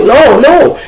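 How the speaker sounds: retold self pleading with the officer; urgent denial. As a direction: jump in fast to deny it. Denies gang charge to officer.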